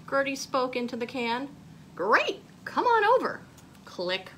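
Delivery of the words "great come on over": much higher and louder, eager; excited invitation. Acted. Molly inviting Gertie over, excited. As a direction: burst out with the invite.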